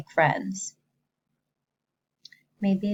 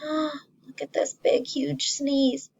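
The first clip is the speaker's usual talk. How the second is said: slow wide-eyed high pointing out; mock-amazed teacher; 8 semitones higher, slower than usual. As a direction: wow the kids with the picture. Showing the picture.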